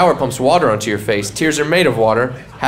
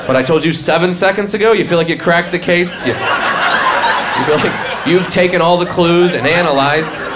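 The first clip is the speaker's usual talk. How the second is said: raised, mocking sarcasm; sarcastic; 4 semitones higher than usual. Real, not acted. Mocking a friend who thinks he cracked the case, sarcastic. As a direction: needle him for his great detective work. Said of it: "mocking friends who crack case".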